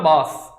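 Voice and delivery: बास with an "ah", like "baas"; wrong A vowel. Shown as a demonstration. Teacher quoting what people say, बास with an ah. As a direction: say बास with a long ah. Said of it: "बास is absolutely wrong".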